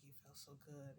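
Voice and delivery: very soft, low quote; hushed retold praise. He praises her afterwards, hushed and low. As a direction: imitate him, barely audible. Retelling his words afterwards.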